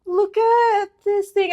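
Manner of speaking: tender mock-mom voice, drawn out; imitating touched mom. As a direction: coo over a keepsake like a mother. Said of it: "it wasn't in her voice when she's like".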